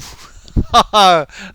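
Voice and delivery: high, loud burst of laughter; real belly laugh bursting out. Real, not acted. Caught out by a listener's jibe, loud laugh.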